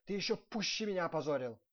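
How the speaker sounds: loud, furious accusation; angry yelling. A narrator's reading. Prince blaming his steward for the shame, angry yell. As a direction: yell at him for disgracing you. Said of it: "орал князь".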